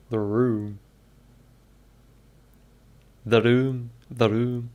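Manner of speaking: trying out a Scottish vowel in "room"; practising Scottish accent. Shown as a demonstration. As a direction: say "room" as a Scottish pronunciation drill. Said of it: "How to do a Scottish accent".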